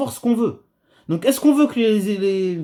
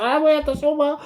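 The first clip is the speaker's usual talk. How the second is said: mock objection; 7 semitones higher than usual. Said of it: "on va me dire".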